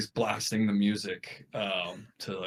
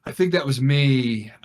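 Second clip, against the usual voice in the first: sheepish confession; 3 semitones higher, 7 dB louder than usual. Retold or imitated. Confesses to the cop.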